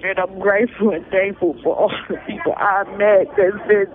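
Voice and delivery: ordinary talk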